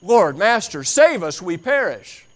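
high, loud pleading cry; desperate cry. Acted. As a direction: beg for rescue at full voice. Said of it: "yelling and screaming".

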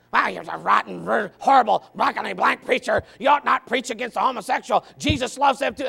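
mock outraged rant, punched words; hammed-up indignant complaint; indignant letter-writer. Anonymous critic writing to the preacher, mock indignant.

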